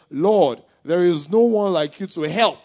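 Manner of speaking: loud, imploring cry to the Lord; calling on God. Acted. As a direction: read the prayer out as a strong, rising plea. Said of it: "Then Asa called to the Lord".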